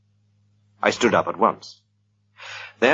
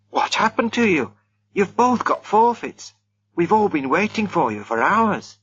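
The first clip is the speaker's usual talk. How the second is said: lightly voiced youth, higher; young man's voice; 11 semitones higher than usual. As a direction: play the impatient young host. Reggie scolding the missing pair.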